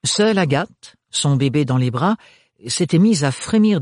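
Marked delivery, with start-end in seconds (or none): none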